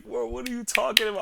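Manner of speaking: high-pitched mock disbelief; incredulous reaction. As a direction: mimic a baffled classmate's whoa. Classmates' startled reaction, quoted.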